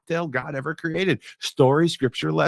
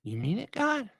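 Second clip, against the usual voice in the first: hopeful question; 7 semitones higher, 8 dB quieter than usual. Acted. Todd asks God, hopeful.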